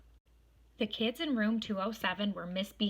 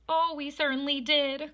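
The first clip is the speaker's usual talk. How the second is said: put-on higher, louder joyful kids; cried out; 7 semitones higher, 5 dB louder than usual. A narrator's reading. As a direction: cheer it like relieved children. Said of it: "cried all the kids".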